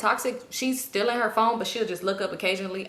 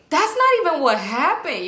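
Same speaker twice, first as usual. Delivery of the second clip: loud, high burst of disbelief; incredulous objection; 5 semitones higher, 5 dB louder than usual. Retold or imitated. Girl correcting dad's chainsaw story, loud incredulous outburst. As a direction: object loudly, pitch jumping up.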